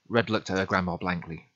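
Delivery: ordinary delivery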